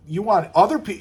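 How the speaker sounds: ordinary talk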